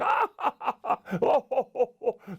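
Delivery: high "ha-ha" into "ho-ho"; elephant's rolling guffaw; hearty acted laughter. A narrator's reading.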